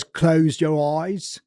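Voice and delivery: "Close your eyes" drawn out slowly in a British accent. Shown as a demonstration. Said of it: "No one says".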